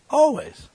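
Always leaps high and loud; punched single word. Real, not acted. Preacher insisting to congregation, Always high and loud. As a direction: hit Always with a sharp high peak.

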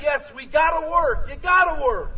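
loud, high, hammering on gotta; shouted exhortation. Real, not acted. Preacher to congregation about hard work, shouting. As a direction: preach it out loud so the back row hears.

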